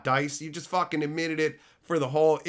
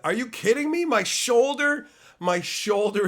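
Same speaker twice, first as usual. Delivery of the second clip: exasperated outburst; 8 semitones higher, 4 dB louder than usual. Real, not acted.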